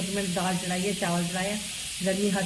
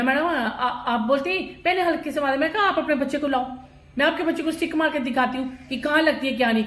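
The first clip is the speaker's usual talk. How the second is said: heated challenge; 6 semitones higher, 3 dB louder than usual. Retold or imitated. Mother challenging the teacher.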